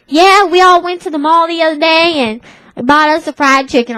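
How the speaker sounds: high, loud Tennessee twang. Retold or imitated. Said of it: "with this Hickish accent".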